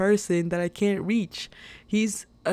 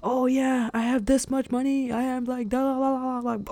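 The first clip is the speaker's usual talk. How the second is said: higher, mock boastful social media voice; show-off imitation; 6 semitones higher than usual. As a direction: parody a bragging influencer, trailing off. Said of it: "people on social media are, like, always".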